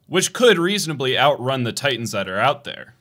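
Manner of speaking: ordinary talk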